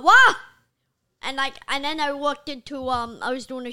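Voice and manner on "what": loud, high mock-offended shout; sharp rising yell, acted out; imitated outraged reply. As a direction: fire back as the affronted guy. Shop guy's outraged reply, retold.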